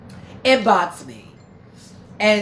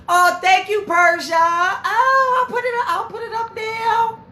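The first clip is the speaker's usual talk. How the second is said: high, loud, near-tearful squeal of thanks; overjoyed thanks; 13 semitones higher, 4 dB louder than usual. Real, not acted. Streamer thanking viewer Persia for cash, thrilled squeal. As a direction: gush over the gift, voice cracking with joy.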